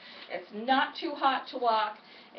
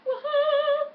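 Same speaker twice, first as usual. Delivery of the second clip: cheer; 14 semitones higher, 6 dB louder than usual. Real, not acted. Cheering as she sets out.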